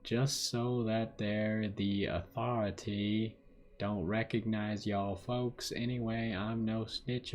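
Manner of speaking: slow southern drawl; southern accent. Acted. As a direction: read the letter like a slow-talking southerner. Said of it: "like a southern accent".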